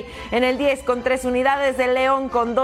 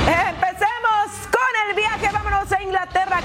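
announcer; 7 semitones higher than usual. Acted. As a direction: announce the trip like a host. Presenter segue announcement.

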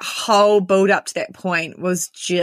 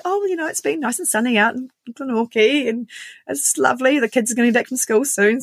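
higher-pitched, put-on casual chat; forced small talk; 7 semitones higher than usual. Customer answering the receptionist while pretending all is normal, high and casual. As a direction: lightly mimic breezy small talk.

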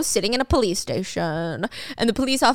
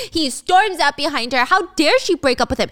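ordinary delivery

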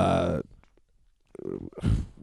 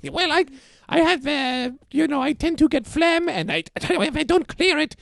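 much higher put-on woman's voice; 6 semitones higher than usual. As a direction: falsetto lady character. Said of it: "I end up talking like this".

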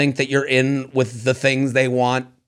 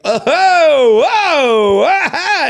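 mock ogling; 10 semitones higher, 4 dB louder, much slower than usual. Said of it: "someone was like".

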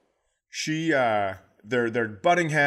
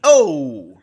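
calling out; 2 semitones higher, 11 dB louder than usual. Retold or imitated. Comedian mimics a startled parent, loud. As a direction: call out sharply, startled.

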